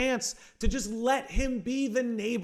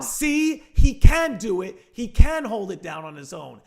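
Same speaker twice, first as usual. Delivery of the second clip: loud, proud cheer; excited; 9 dB louder than usual. Retold or imitated. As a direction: cheer him on loudly. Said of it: "it's like".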